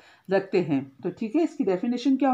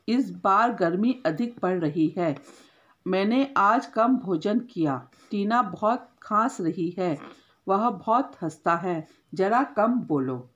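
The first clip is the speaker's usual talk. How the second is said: slow dictation reading; slower than usual. Shown as a demonstration. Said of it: "reads example sentences".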